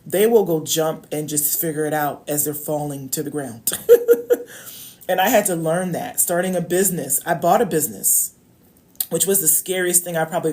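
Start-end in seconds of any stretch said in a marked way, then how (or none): 3.8-4.6 a sudden high burst of laughter; loud laugh. Real, not acted. A vlogger laughing at her own picture of men falling.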